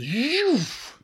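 a high, sharp whooshing zhoof; zhoof sound effect. Retold or imitated. Teacher mimics the mentor's sudden sweeping look, whooshing zhoof. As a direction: make the sweep of his gaze a high whoosh. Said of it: "And then he goes".

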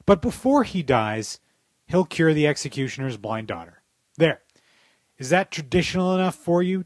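a dry, wry reading of a written rant; read-aloud op-ed. Acted. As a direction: deliver the essay like a sarcastic column. Reads a written satirical essay.

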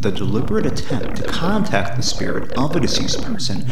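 slow, low narrator read; documentary narration. Acted. Reads encyclopedia intro over music.

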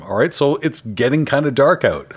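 normal talking voice

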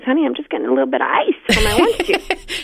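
light, high, sweetly innocent voice; innocent fib to husband. Pretend. Wife sweetly fibbing to husband about her juice, innocent voice. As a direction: sound all innocence so he suspects nothing. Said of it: "all you say to your husband is".

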